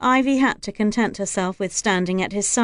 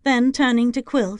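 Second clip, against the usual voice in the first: ordinary delivery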